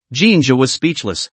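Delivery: ordinary delivery, nothing marked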